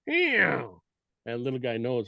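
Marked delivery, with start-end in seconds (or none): 0.1-0.8 high raspy mew, falling; a mother elk calling her calf back sternly; raspy cow mew. Retold or imitated. Elk mom calling her straying calf, high raspy mew. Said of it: "she'll give a little more raspy one".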